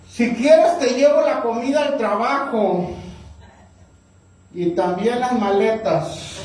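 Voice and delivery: wife sarcastically offering to bring his lunch, ironic and slow. Retold or imitated. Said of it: "con un tono irónico".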